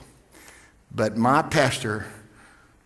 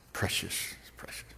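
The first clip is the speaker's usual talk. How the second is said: hushed whisper; 6 semitones lower, 13 dB quieter than usual. Real, not acted. Preacher moved, hushed over cards.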